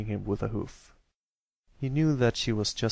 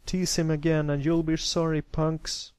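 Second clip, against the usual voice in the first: raised, higher protective threat; angry warning; 6 semitones higher, 3 dB louder than usual. Acted. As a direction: warn the bullies off, voice up. Brother warning the fleeing bullies.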